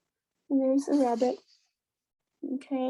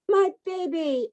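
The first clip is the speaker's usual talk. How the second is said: mother fox relief; 9 semitones higher than usual. A narrator's reading. Mother fox finds cub in story.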